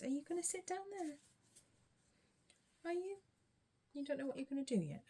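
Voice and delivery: high quick coaxing voice; pet talk voice. Real, not acted. Talking to the cat.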